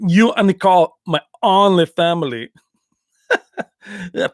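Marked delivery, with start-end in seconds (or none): 0.1-2.5 mock Van Damme. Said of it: "There was a Lionheart".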